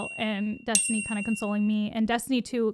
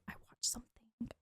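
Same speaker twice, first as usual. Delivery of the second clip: very soft, near-whispered quote; hushed retelling; 19 dB quieter than usual. Retold or imitated. Retelling his confession about the TV, hushed. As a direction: drop to a whisper, recalling his words. Recalls what he hesitantly told her.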